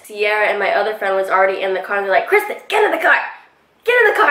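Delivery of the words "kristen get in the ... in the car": high, squeezed mock yell; urgent calling out. Friends call her over to the car, urgent high mock yell. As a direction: imitate friends hollering, pitched far up.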